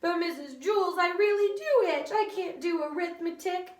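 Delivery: high, loud pleading whine; Dana whining. A narrator's reading. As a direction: beg the teacher. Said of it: "Dana whined".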